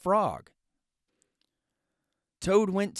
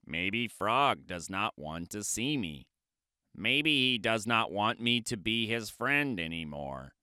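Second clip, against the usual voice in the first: lower, softer worried Toad; sad Toad; 6 semitones lower, 5 dB quieter than usual. Acted. Toad fearing Frog's friendship is over, sad. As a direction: fret it quietly as Toad.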